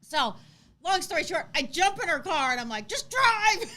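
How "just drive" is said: urgent shout. Retold or imitated. Urging a panicked driver.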